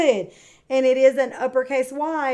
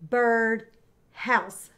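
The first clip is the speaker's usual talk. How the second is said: birdhouse with a pause between bird and house; bird, gap, house for birdhouse; split into syllables. Shown as a demonstration. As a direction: break birdhouse into two beats. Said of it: "Let's do that one more time".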